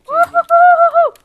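high delighted whoop; excited cheer. Real, not acted. Player cheering at coming first, excited whoop. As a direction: celebrate winning the round out loud.

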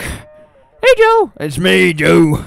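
calling out to Joe in a squeaky character voice; high put-on character voice. Streamer voicing his character hailing his buddy Joe, high goofy call. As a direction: pitch way up and holler like a goofy cowboy.

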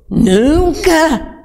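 "nunca" thrown high and drawn out; emphatic, high-pitched "nunca". Real, not acted. Emphatic denial in testimony.

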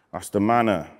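normal talking voice

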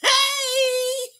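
long, high "hey" squeal; high whoop. Acted. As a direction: squeal a drawn-out greeting to the crowd. Host greeting the crowd.

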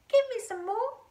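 high, loud demand from an excited granny; eager cry. A narrator's reading. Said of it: "she cried".

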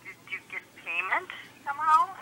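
ordinary talk, nothing marked

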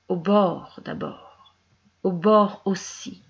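looping, incantatory verse reading; rhythmic recitation, rushing then lingering; poem recitation. Acted. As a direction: let the repeated words surge and subside. Reads a wordplay poem.

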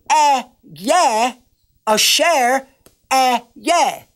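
"Ah, yeah, Asher, ah, yeah" said slowly as Hebrew syllables; Hebrew Ehyeh asher Ehyeh. Shown as a demonstration. Pastor giving listeners the Hebrew name, careful Hebrew. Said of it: "or in Hebrew".

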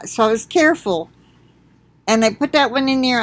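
ordinary delivery

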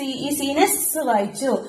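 slow and soft, സ് drawn out; slow teaching. Shown as a demonstration. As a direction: explain slowly, soft, stretch സ്. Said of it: "സ്, സി". (പതുക്കെ മൃദുവായി വിശദീകരിക്കുക)

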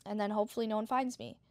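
usual speaking voice

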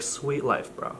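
quiet flat sarcastic aside; answering a bragging friend, quietly sarcastic; deadpan sarcasm. Pretend. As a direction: say it totally unimpressed. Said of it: "you could just be like".

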